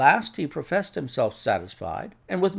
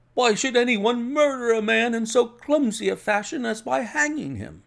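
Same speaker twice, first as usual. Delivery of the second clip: high-pitched, disbelieving question; incredulous protest; 10 semitones higher, 3 dB louder than usual. Acted. Inspector challenges Holmes's murder theory, incredulous.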